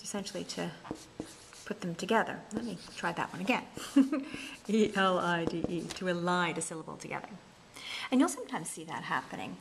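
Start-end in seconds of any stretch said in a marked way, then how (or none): 4.7-5.8 "E L I D E" letter by letter; name each letter of "E L I D E" separately; spelled out. Shown as a demonstration. Spelling out the word elide.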